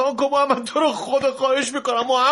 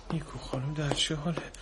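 coaxing softly; 11 semitones lower, 7 dB quieter than usual. Acted. Doctor coaxing sleeping child.

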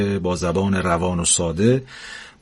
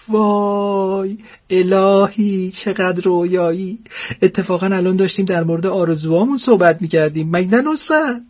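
put-on swooning old wife; 9 semitones higher than usual. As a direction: gush like a dazzled old lady.

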